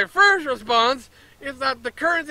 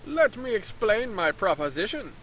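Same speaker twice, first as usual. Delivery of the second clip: lightly put-on Canadian-ish accent for the merchant; a hint of a Canadian merchant explaining his plan; 6 dB quieter than usual. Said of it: "what accent is that supposed to be?".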